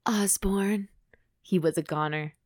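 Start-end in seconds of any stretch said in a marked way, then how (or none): 0.1-0.9 murmured low. A narrator's reading. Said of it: "murmured low".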